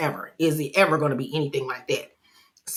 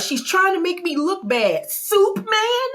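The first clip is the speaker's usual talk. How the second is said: mock outburst; 13 semitones higher than usual. Voicing Angie's outburst.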